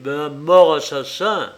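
loud cavernous pronouncement; booming demon lord. Acted. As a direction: proclaim it like a prophet. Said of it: "d'une voix caverneuse".

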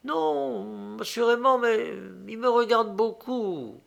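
very high, hesitant feminine voice; embarrassed young woman. A narrator's reading. Young woman admits the count stares at her, embarrassed. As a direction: draw it out high and reluctant.

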